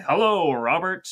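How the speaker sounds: a hint of the deep-voiced grandfather; grandfather's deep voice. Grandfather greeting grandchild at the house, lightly imitated deep voice. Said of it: "very, very deep voice".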